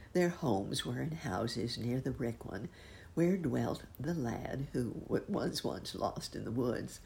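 ordinary delivery

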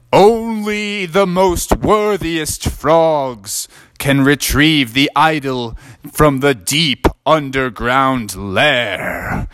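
slow, grand knight voice; an old knight bestowing the prize, slow and grand; solemn knight. Acted. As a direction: proclaim it slowly like a ceremonial knight. Knight intones the warning.

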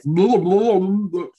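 loud queasy "blah" noises; stomach-turning noise. Shown as a demonstration. Reacting to the scary mimic idea, imitated queasy noise. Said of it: "It's making my stomach turn".

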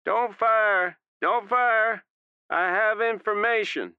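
high, loud desperate shout. A narrator's reading. Stubbs shouting to armed men not to fire. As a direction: shout to stop them shooting. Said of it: "Stubbs shouting".

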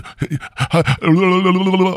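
high rapid tongue-rolling gibberish; comic high-pitched glossolalia; mock speaking in tongues. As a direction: babble "lalala" as if possessed. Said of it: "talking the tongues".

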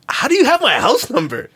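high-pitched retold disbelief; incredulous demand. Customer confronting seller over the house number, incredulous. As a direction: send the voice way up in disbelief.

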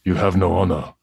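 the samurai line with an Australian accent creeping in; Australian-tinged attempt at the character voice; half-Australian samurai voice; slipping into Australian accent. Shown as a demonstration. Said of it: "I was gonna do it in Australian accent".

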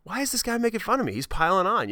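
a hint of a hurt child; mock outraged kid; kid's indignation. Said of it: "And I'm like".

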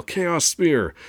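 high, loud attack call; storyteller yelling out a power move; yelled battle cry. A narrator's reading. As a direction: shout the special move as you strike. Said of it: "Then I yell".

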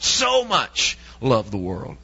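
"So" drawn out at the start, very slow; stress on so. Shown as a demonstration. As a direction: lean hard on "So much". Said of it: "all caps those two letters".

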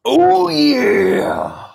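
high, loud, stretched-out wrestler catchphrase. Acted. Excited for the wrestler's album, booming wrestler impression. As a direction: belt it out long like a pro wrestler. Mimics wrestler [name].

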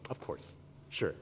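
very soft, low, resigned concession; reluctant agreement. Retold or imitated. Dad agreeing to stay for son's teammates, quietly reluctant.

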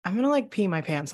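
normal talking voice

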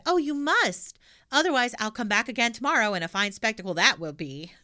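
put-on loud, bold little girl; cheeky girl voice. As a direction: blurt it out brashly. Pippi insists on gift.

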